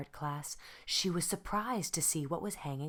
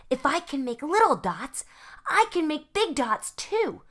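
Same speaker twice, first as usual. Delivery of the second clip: excited; 11 semitones higher, 9 dB louder than usual. Acted. Vashti excited experimenting.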